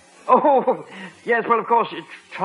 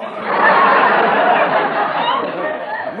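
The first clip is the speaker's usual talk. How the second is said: shrill, far higher nervous titter; a giggle he can't hold back; nervous laugh; 4 dB louder than usual. Acted. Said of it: "there I go again".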